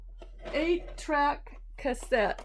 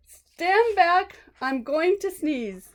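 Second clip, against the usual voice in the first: dramatic title; 7 semitones higher, 6 dB louder, slower than usual. Acted. Dramatic reading of book title.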